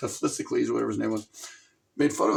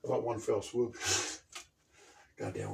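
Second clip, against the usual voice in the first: usual speaking voice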